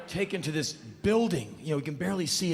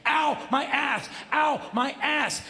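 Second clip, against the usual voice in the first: higher, slow whiny yell; mock pain yell; 10 semitones higher, slower than usual. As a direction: groan about the sore seat. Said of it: "sixty seconds of".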